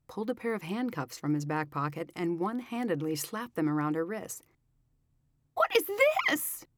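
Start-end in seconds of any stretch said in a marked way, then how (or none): 5.5-6.7 shrill, very high squeak; squeaky. A narrator's reading. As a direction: squeak it in shock. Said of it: "she squeaked".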